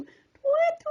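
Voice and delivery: high screeching owl call; high eerie bird cry. Acted. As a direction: hoot up high like a night owl.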